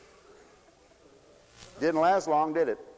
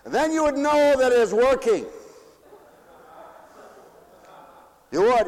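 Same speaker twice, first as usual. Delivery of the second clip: raised, loud preaching voice; preaching at full force; 11 semitones higher, 7 dB louder than usual. Acted. Preacher rousing the congregation with praise, loud and raised. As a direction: call it out to the pews.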